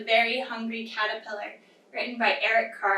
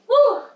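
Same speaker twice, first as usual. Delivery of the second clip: loud high "woo" after a big effort; exerted whoop; 10 semitones higher, 9 dB louder than usual. Pretend. Teacher finishing ten bites with children, loud high whoop. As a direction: let out a big playful whew.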